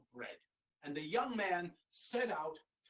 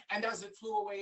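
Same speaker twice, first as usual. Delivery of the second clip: usual speaking voice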